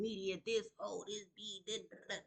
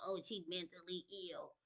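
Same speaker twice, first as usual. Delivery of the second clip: mock accuser; 7 dB quieter than usual. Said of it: "talking about".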